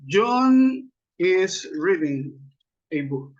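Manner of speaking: very slow, stretched-out model sentence; slow dictation. Shown as a demonstration. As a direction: draw out each word, pause before the end. Dictates example while building it. (dictado muy lento)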